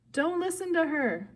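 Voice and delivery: high-pitched called warning; urgent shout. A narrator's reading. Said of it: "Annabeth's voice shouted somewhere".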